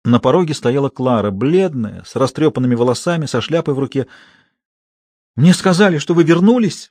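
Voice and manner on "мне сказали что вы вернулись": high, loud theatrical cry; exclaimed. Acted. Actress Klara bursts in, exclaiming loudly. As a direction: burst in crying out to him. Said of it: "вскричала она и немедленно разрыдалась".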